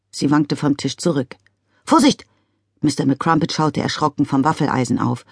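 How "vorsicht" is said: high, loud alarmed cry; alarmed warning. A narrator's reading. Father startled, warning his daughter.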